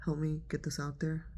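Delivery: usual speaking voice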